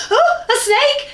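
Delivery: slow, very high, loud shriek of fright; alarmed cry. Acted. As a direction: shriek it as if something touched you. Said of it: "cried Beth in alarm".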